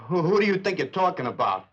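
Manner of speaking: angry outburst; shouting. Acted. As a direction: yell it back in offense. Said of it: "Don't shout at me".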